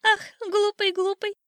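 very high, loud, mocking bird voice; laughing old sparrow. A narrator's reading. Sparrow laughing at the silly rooster, high jeering. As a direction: ridicule him in a high chirp. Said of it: "засмеялся над ним старый воробей".

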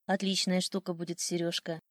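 ordinary delivery, nothing marked

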